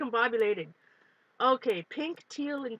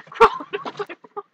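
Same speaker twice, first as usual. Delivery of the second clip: laughing; 10 semitones higher, 6 dB louder than usual. Real, not acted. Laughing after spider fright.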